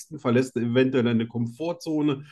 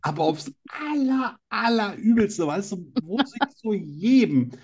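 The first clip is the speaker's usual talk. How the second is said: excited; 4 semitones higher than usual. Real, not acted. Building outrage about the book.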